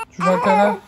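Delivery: ordinary talk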